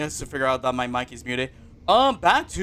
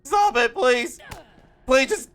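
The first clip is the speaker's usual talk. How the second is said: higher, louder pleading; half-joking desperate plea; loud begging; 9 semitones higher, 4 dB louder than usual. Real, not acted. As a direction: plead with the game to stop. Begging during the match.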